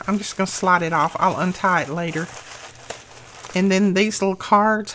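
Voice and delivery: ordinary talk, nothing marked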